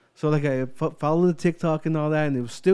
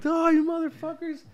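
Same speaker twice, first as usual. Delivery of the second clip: mock outrage.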